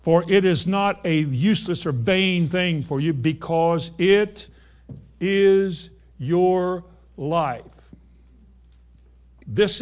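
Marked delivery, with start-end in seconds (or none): 0.0-7.6 very slow, every word weighted; emphatic slow reading. Shown as a demonstration. Preacher stressing that the word is your life. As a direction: drag it out to drive it home. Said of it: "this is the emphasis now that he puts on the word".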